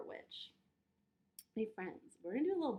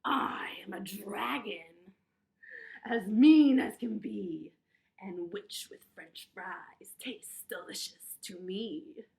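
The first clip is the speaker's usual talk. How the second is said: slow, growly dragon voice, loud at first; menacing dragon; 2 semitones lower, 11 dB louder, much slower than usual. A narrator's reading. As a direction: loom over them as a mean dragon. Dragon speaking in story read aloud.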